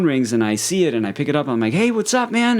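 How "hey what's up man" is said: high, louder, upbeat hello to a buddy; cheery phone greeting. Retold or imitated. Narrator answering his friend's call, high cheerful greeting. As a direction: re-enact picking up brightly, pitched way up.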